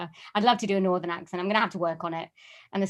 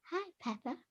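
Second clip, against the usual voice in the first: soft, high-pitched little cartoon piglet; hushed, high cartoon child character voice; Peppa Pig; 8 semitones higher, 13 dB quieter than usual. Shown as a demonstration. Guest trying a cartoon voice for the host, soft Peppa Pig impression. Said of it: "do a Peppa Pig".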